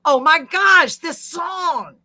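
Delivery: high, loud gushing outburst; hammed-up high-pitched amazement; Joanne's exclamation. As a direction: cry it out in overwhelmed admiration. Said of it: "Joanne said it like this".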